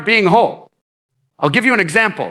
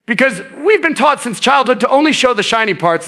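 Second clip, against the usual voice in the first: ordinary delivery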